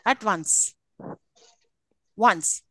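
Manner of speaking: "At once" with a falling pitch; isolated falling "once". Shown as a demonstration. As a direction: drop down on each "once". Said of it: "It's again a fall".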